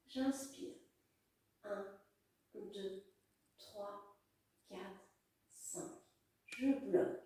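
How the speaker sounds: soft, fading breath count; guided breathing count. Acted. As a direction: count softly, letting it fade.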